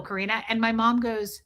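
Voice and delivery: ordinary talk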